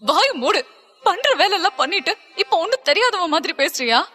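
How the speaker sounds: high, indignant female voice; angry rebuke. Acted. Stella accusing Alex of playing innocent, high and angry. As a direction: shut him up, pitched high with anger. Acted quarrel, woman cuts man off.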